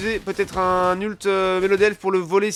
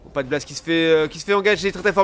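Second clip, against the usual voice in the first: usual speaking voice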